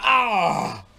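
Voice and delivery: high choked cry; gagging cry. Retold or imitated. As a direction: react to the mouthful. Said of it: "He like".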